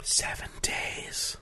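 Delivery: soft, breathy whisper. Real, not acted. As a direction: whisper it under your breath. Whispered aside during the show.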